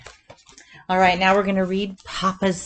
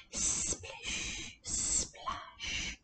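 soft splash; 15 dB quieter than usual. A narrator's reading. Otter pups slide into river.

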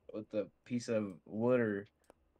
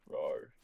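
dinosaur voice; 8 semitones lower than usual. Pretend. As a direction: growl it like a dinosaur. Repeats roar joke.

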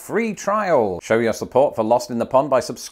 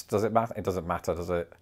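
murmured to the dog; 7 semitones lower, 9 dB quieter, faster than usual. Real, not acted.

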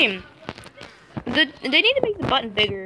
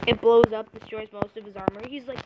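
ordinary talk

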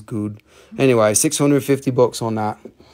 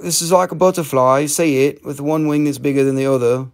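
ordinary talk

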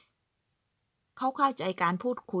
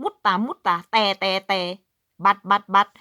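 lightly voiced Finn saying but, louder; mock foreign but; 4 dB louder than usual. Imitating Finns saying but in Finnish and English, a bit louder. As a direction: hammer the buts. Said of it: "เขาบอก".

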